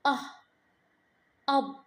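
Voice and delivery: "أه" then "أب" isolating the short u of up; short u sound. Shown as a demonstration. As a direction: sound out "أه" and build it into "أب". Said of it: "صوت اليو القصير هنا أه".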